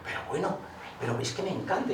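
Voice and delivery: high-pitched imitation of a delighted friend; imitated delight. Retelling how poet friend reacted to the poem, high delighted voice. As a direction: voice jumps up in retold enthusiasm. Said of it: "le encantó el poema este".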